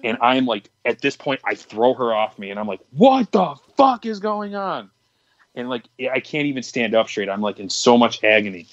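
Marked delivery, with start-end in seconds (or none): 2.9-4.9 high, drawn-out cry of alarm; horrified yell. Retold or imitated. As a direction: yell it slowly, pitched way up in disbelief. Acted horrified yell at the girl.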